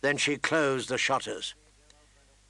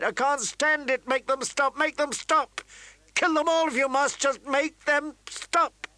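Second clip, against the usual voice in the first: hysterical crying; 12 semitones higher than usual. Acted. Catelyn breaking down over the howling wolves. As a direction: break down begging it to end. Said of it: "she cried".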